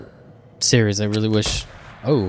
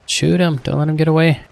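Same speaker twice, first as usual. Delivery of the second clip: higher-pitched, eager urging at the screen; urgent urging; 8 semitones higher, 3 dB louder than usual. Real, not acted. Viewer urging the hunter on screen to shoot, urgent urging. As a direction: egg the hunter on, pitch lifted.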